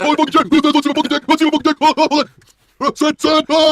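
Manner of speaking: high, loud mock leering moans; hammed-up pervert. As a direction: ham up a creep begging.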